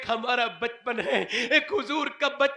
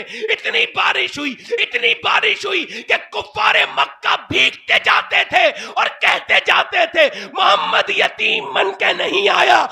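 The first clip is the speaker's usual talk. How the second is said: fiery preaching; 5 semitones higher, 9 dB louder than usual. Acted. Preaching at full force.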